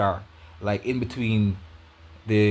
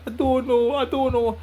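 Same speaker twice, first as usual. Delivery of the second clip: high, whiny crying imitation of the girlfriend; mimicking her crying; 13 semitones higher than usual. Teller imitating girlfriend's tearful high-pitched answer. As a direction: squeak it out like her tearful reply.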